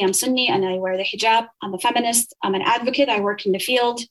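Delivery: ordinary talk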